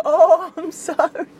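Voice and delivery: high, drawn-out apology through laughter; slow, high-pitched laughing sorry. Retold or imitated. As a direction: re-enact a sorry while cracking up. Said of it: "I started laughing".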